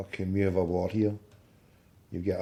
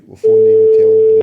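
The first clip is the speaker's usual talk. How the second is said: high, loud declaration; loud shout; 15 dB louder than usual. Real, not acted. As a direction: announce it at full volume. Shouting out to viewers.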